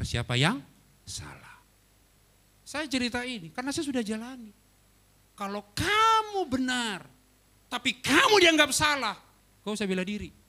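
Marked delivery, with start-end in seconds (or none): 1.1-1.6 breathy whispered final word; whispered punchline. Real, not acted. 5.4-10.3 preacher pressing the lesson on the congregation; emphatic preaching. Real, not acted. Preacher driving home lesson.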